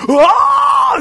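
high-pitched mock scream of fright; startled yelp, loud and very high, hammed up; scared scream. Scared classmate caught with marijuana, imitated scream. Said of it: "that guy looked scared".

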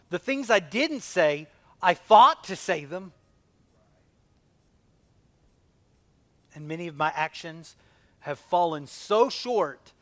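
loud impassioned confession; fervent sermon voice; preaching. Acted. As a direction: cry it out to the church. Preaching at full force.

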